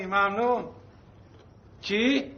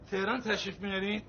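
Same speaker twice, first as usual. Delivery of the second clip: ordinary talk, nothing marked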